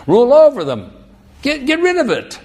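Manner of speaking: loud, high urging; emphatic command. Acted. Preacher voicing what Adam should have done, loud command. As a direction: bark it like an order.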